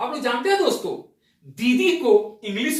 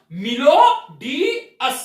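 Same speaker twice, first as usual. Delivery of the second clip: "मिलो डी us" stretched slowly, louder on मिलो; slow, stressed; 5 dB louder, much slower than usual. Shown as a demonstration. Teacher drawing out the trick phrase for viewers. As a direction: drag out the pun for effect. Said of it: "क्या बोला दोस्तों?".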